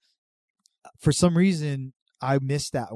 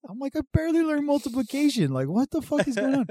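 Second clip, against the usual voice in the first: put-on high, louder exasperated kid voice; retold baffled kid; 14 semitones higher, 4 dB louder than usual. Boy scout lost over a pancake recipe, high exasperated whine. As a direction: squeak out total confusion.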